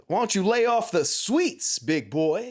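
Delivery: high, loud mock taunt; taunting announcer. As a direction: jab at the player from the machine.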